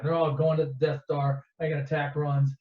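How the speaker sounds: ordinary talk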